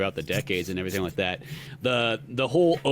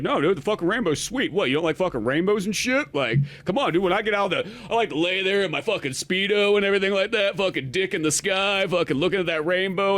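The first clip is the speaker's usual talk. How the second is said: higher, cocky surfer-dude Namor; mock Namor; 7 semitones higher than usual. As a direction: brag like a laid-back beach bro. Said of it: "It's like".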